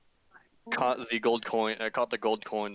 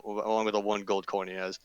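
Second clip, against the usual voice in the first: ordinary delivery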